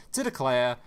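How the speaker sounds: ordinary talk, nothing marked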